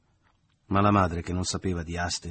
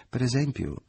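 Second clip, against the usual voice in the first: higher-pitched, foolishly eager suggestion; lightly voiced silly mother; sublimely stupid; 6 semitones higher, 4 dB louder than usual. A narrator's reading. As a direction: offer an idea with blithe silliness. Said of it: "disse la madre con eccelsa stupidità".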